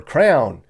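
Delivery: crown, one clipped syllable for crayon. Shown as a demonstration. Wisconsin crayon said as crown. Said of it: "how people say the word crayon".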